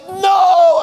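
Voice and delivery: high loud shout of disbelief; shocked no. Retold or imitated. Retelling the colleague's shock at the prank, loud mock yell. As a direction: yell it like you just got fooled.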